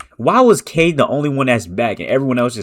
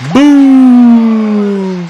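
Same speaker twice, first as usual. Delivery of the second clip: long drawn-out high boom; excited shout; 9 semitones higher, 6 dB louder than usual. Real, not acted. Fan watching the game hypes up the big play, loud drawn-out boom. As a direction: yell it out as the shot drops.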